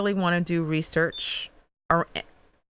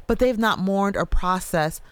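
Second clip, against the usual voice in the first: normal talking voice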